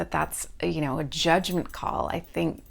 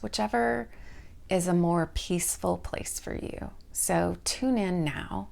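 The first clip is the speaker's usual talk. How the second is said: guided meditation. Real, not acted. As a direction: lead them gently down the body, stretching each part's name. Leads a guided body scan.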